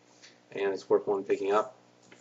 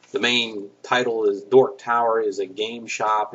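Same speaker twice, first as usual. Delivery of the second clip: ordinary talk, nothing marked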